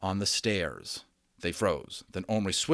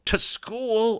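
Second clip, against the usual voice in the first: much higher, louder shocked cry; aghast; 14 semitones higher, 6 dB louder than usual. A narrator's reading. Omri reacts to bringing Little Bear to school, aghast. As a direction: cry out in disbelief. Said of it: "Omri cried aghast".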